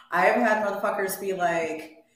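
ordinary delivery

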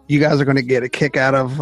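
usual speaking voice

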